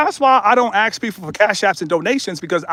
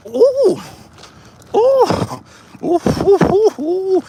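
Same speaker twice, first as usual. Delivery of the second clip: high ooh ad libs; 10 semitones higher, much slower than usual. Pretend. Mocking the wire transfer talk with high hoots. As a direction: echo the tunnel with high ooh calls. Ad lib in the tunnel.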